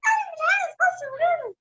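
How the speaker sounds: put-on high, fast, whiny child voice; little bunny voice. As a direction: squeak it like a hungry bunny. Bunny refuses food.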